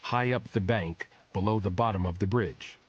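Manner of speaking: usual speaking voice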